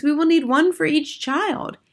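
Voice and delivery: high-pitched protest from the crowd; crying out. Acted. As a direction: object as a worried witch audience. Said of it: "cried the audience".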